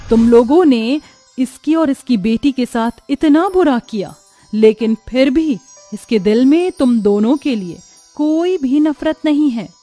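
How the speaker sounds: loud, commanding divine rebuke; stern goddess. Acted. Goddess shaming the two false devotees, stern and loud.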